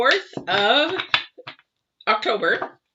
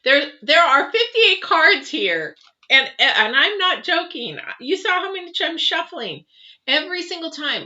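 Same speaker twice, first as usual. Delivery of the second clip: excited; 9 semitones higher, 5 dB louder than usual. Real, not acted. Amazed by the count of cards.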